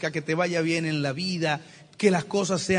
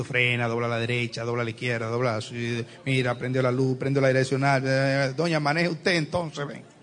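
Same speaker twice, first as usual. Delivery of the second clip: low, bossy backseat-driver wife barking directions; nagging wife; 5 semitones lower than usual. Acted. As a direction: boss him around from the passenger seat like a nagging wife. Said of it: "especialistas diciendo". (esposa mandona dando órdenes al marido que maneja, voz grave)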